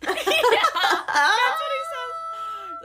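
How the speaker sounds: words squeezed out through high laughter; laughing. Real, not acted. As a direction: laugh helplessly while saying it, slowly. Laughing at the quip.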